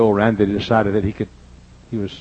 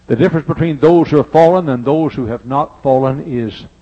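louder, higher pulpit build; preaching at full force; 4 semitones higher, 7 dB louder than usual. Acted. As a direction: swell into the point for the congregation. Preaching climax.

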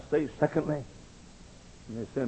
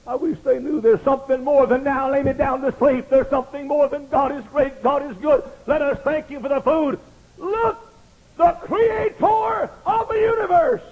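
high, ringing pulpit cry; fervent preaching; 12 semitones higher, 7 dB louder than usual. Real, not acted. Preacher urges congregation toward the Creator, crying out ever higher. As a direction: climb to a slow, soaring climax.

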